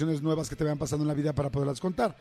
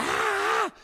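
high, drawn-out mock scream of pain; imitating a child's scream from a car door; retold scream. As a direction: let out a much higher childhood yelp, slow. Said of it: "yo gritaba y gritaba".

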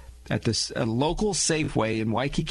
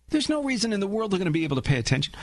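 ordinary delivery

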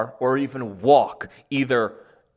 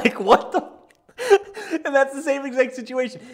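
high-pitched laughter through the words; laughing; 13 semitones higher, 3 dB louder than usual. Real, not acted. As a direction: laugh while talking. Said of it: "laughing at own impression".

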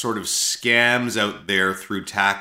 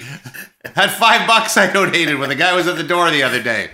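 high put-on voice, laughing; playing the wife, hammed up; mock wife voice; 7 semitones higher, 4 dB louder than usual. Answering in character as wife.

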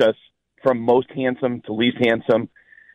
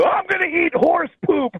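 a much higher, goofy fan voice; mock Eagles fan. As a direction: play the dumb rowdy fan. Voicing an Eagles fan's reply.

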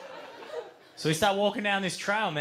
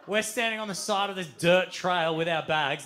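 usual speaking voice

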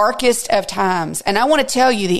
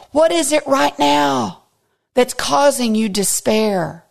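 normal talking voice

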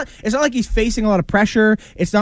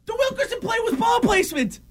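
very high, strained yell of protest; indignant shout; 6 dB quieter than usual. Real, not acted. Co-host insisting on his point about one play, indignant shout. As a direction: yell your point over the argument. Heated argument over ball placement.

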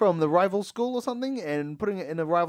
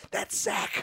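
hushed; 6 dB quieter than usual. Retold or imitated. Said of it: "I was like, in my head".

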